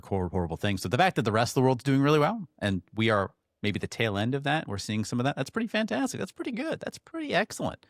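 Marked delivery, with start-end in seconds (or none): 5.4-7.9 delighted. Real, not acted. Praising good news.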